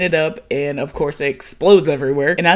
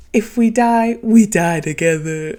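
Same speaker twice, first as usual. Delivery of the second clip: mock crazy devotion; 5 semitones higher, 3 dB louder than usual. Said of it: "on some crazy bitch shit".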